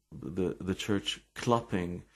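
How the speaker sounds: ordinary talk, nothing marked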